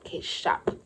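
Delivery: voiceless, resigned okay; deflated whisper. Retold or imitated. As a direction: exhale it in defeat. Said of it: "I'm like".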